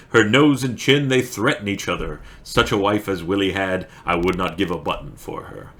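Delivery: lively, emphatic verse reading; poem recitation. Acted. Streamer reading Burns poem for story time, emphatic recitation. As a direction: punch each line, easing off into the refrain. Reads requested poem aloud.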